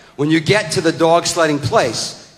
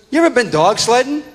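put-on higher-pitched kid's question; son's voice; 9 semitones higher than usual. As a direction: mimic an eager child asking dad. Acting his son's question.

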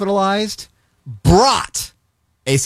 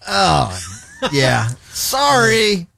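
drawn-out, very slow apology, pitched up; mock sheepish burglar; 3 semitones higher, much slower than usual. Burglar caught at gunpoint, slow sheepish mock apology. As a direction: stretch the sorry out sheepishly. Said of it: "you're like".

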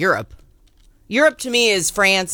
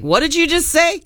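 surprised; 9 semitones higher than usual. Retold or imitated. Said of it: "Travis and I were both like".

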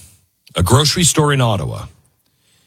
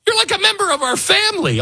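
mock hurt leader; faster than usual. Voicing Trump to Canada.

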